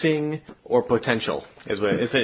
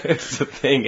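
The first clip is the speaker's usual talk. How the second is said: laughing; 4 semitones higher than usual. Real, not acted.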